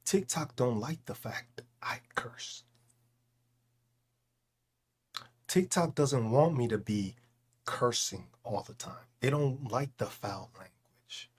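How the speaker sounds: hushed whisper, voice dropped to breath. Real, not acted. Streamer whispering to chat about TikTok. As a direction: whisper it so the platform won't hear.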